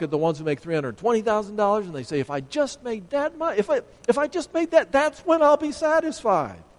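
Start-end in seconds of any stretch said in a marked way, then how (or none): 2.2-6.6 mock craving whine. Escalating greed, imagined earner speaking.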